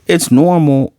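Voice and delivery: put-on high, small little-girl voice; childlike Anya voice. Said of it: "And she's like".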